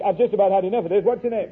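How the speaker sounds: exasperated policeman. Retold or imitated. Policeman questions the second prince, acted exasperation. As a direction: play an officer who is fed up, rushing the question.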